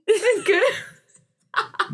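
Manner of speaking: high, drawn-out lament; mock wail. Student mourning the play she missed, melodramatically wailing. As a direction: wail it slowly in hammed-up heartbreak.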